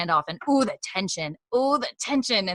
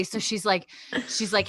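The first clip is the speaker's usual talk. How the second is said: usual speaking voice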